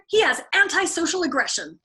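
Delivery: a shrill I-told-you-so; Clevenger insisting. Acted. Clevenger lecturing, worked up.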